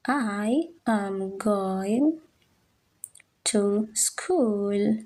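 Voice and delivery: I am going drawn out word by word; slow teacher dictation of I am going. Shown as a demonstration. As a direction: spell it out slowly for the class.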